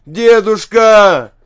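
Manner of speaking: long, drawn-out high shout; loud, pleading call at high pitch; desperate cry. A narrator's reading. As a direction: cry out for help, stretching the word. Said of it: "кричит он".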